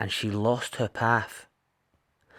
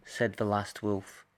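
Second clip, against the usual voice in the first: ordinary delivery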